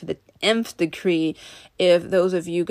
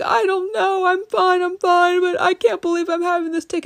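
much higher, strained, tearful voice, imitating herself crying; retold crying; 13 semitones higher, 4 dB louder than usual. Imitating her tearful self telling the police officer she's fine, high and tearful. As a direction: act out her past self near tears, pitch up high. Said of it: "And I just started crying".

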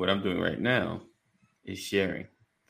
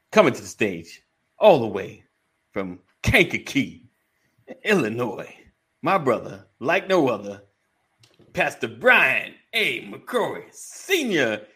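slow, louder hype introduction; stage announcer; 4 semitones higher, 5 dB louder than usual. Pretend. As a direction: announce him like a ring MC. Introducing a guest like an MC.

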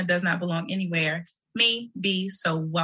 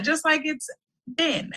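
normal talking voice

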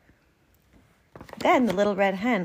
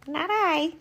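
meowing cat; 10 semitones higher than usual. Acted. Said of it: "meowed the cat".

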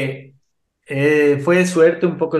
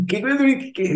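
high-pitched, stammered mock disbelief; retold astonishment; 11 semitones higher, slower than usual. Retelling his reaction to the Messi invitation, mock astonished. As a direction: act out being taken aback, pitch jumping up.

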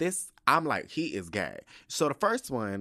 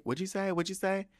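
retold repeated asking; 10 semitones higher than usual. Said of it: "I kept repeating".

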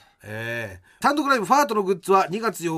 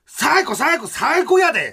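louder, higher catchphrase shout; playful impression of the catchphrase, raised voice; imitating Fujimon; 9 semitones higher, 5 dB louder, much slower than usual. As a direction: mimic the excited catchphrase. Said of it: "ブイティーアール中に言っていました".

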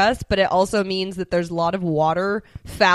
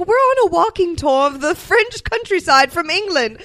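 higher prim British goose; 7 semitones higher than usual. Retold or imitated. Voiced goose line.

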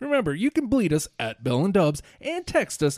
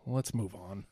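weary sigh; 6 semitones lower, 12 dB quieter than usual. Real, not acted. Host annoyed at co-host.

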